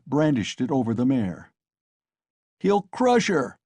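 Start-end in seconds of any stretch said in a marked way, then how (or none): 2.6-3.6 high, slow alarmed cry; shouted alarm. A narrator's reading. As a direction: warn the crowd in dismay. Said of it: "was shouted round him".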